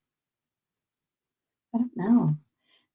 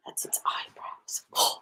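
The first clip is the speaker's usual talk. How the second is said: soft unvoiced whisper; 7 dB quieter, slower than usual. Real, not acted. Showing viewers her cross stitch, slow whisper. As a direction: breathe it out, slow.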